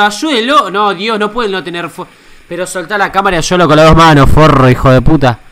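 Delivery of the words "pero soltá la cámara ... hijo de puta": furious yell. Real, not acted. Yelling at cameraman in video.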